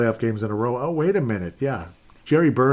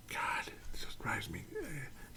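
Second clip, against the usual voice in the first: whispered, very soft disbelief; stunned whisper; 3 semitones higher, 18 dB quieter, much slower than usual. Real, not acted. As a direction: let it fade to a whisper. Stunned again by stats.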